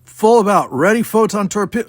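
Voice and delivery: high loud battle order; command shout. A narrator's reading. Captain ordering the ship about, loud command. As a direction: order the turn with force.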